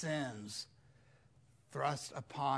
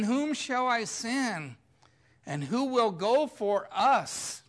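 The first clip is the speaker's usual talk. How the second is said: voice of the Lord; 7 semitones higher, 8 dB louder than usual. Pretend. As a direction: call out as God seeking a messenger. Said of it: "I heard the voice of the Lord saying".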